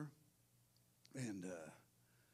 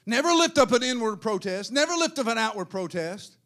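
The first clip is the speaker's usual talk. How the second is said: loud, raised-pitch pulpit proclamation; forceful preaching; 8 semitones higher, 15 dB louder than usual. Real, not acted.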